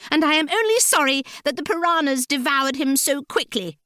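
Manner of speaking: high-pitched heroine's taunt; defiant heroine. A narrator's reading.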